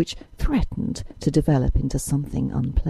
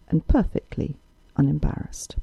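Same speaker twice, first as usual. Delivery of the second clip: plain reading voice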